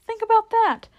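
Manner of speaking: high, loud outburst; indignant exclamation. A narrator's reading. Fawn telling Lucy of the endless winter, exclaiming. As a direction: leap up in pitch and volume, aghast.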